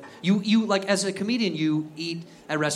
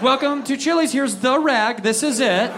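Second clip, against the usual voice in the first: higher, louder perky greeting; chirpy server; 6 semitones higher, 5 dB louder than usual. Retold or imitated. Waiter presenting the rag, perky and louder. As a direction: greet diners like a peppy waiter.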